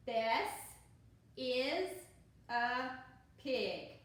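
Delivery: slow, word by word read-aloud for beginners. Shown as a demonstration. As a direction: point and read one word at a time. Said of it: "you use your finger".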